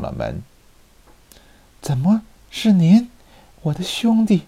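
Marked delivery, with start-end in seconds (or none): 1.8-4.4 high startled exclamation; surprised cry. A narrator's reading. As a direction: gasp it out in shock. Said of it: "惊讶地叫道".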